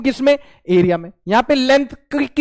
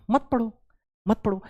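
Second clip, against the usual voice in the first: much softer, offhand reply; quiet dismissal; 9 dB quieter than usual. Real, not acted. Teacher answering student who threatens to quit, quietly dismissive. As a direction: drop the volume and let them go.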